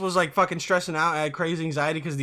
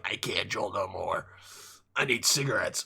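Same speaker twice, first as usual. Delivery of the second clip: much lower, softer mock-whiny voice; mocking Juul kids; 10 semitones lower, 7 dB quieter than usual. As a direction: imitate a burnt-out vaper, low and dragging. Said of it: "and they're like".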